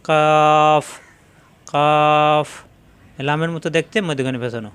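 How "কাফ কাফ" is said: কাফ pressed a little forward on the palate, louder; Arabic letter kaf. Shown as a demonstration. Teacher demonstrating a letter to the class, pressed and louder. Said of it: "জিহ্বার গোড়া একটু আগে বাড়িয়ে উপরে তালুতে চাপ দিয়ে".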